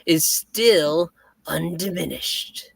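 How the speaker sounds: slow, gloating Green Goblin menace; chilling villain voice. Acted. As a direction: sneer it out like a comic villain. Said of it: "I have a chilling villain voice".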